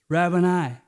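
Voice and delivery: woman recognising her risen master, calling out in joy; joyful outburst. Acted. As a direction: let Rabboni burst out in joy. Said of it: "she burst out with this".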